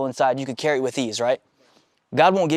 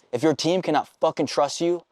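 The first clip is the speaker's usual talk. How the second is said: normal talking voice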